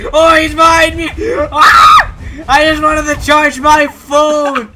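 frantic shout, half crying; panicked yelling. Real, not acted. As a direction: yell in panic. Chased by monster in game.

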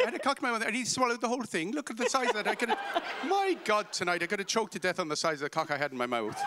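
very high, excitable Kerry voice; hammed-up Irish mother, shrieking on and on; Kerry accent. Irish mother nudging people at the table, in a high, hammed-up Kerry accent. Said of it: "in my best Kerry accent".

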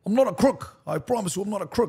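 high-pitched presidential denial; Nixon impression. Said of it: "American president accents".

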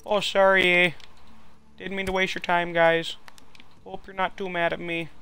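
high, apologetic mock-Canadian voice. Neighbour sorry for wasting time, mock-Canadian apologetic voice. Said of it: "if he's Canadian".

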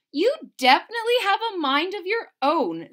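amused mother, a little exasperated; lightly voiced mother; mother's voice. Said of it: "said Chester's mother".

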